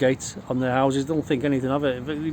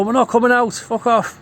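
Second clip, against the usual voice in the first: higher and louder, telling the police to clear off; defiant yell; 7 semitones higher, 3 dB louder than usual. Retold or imitated. Mate shouting at police through door, defiant yell. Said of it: "And they go".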